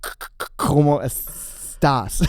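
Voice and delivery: slow stammered Spanish; stutter. Retold or imitated. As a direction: stutter on each word start. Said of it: "it wasn't a s-, stutter".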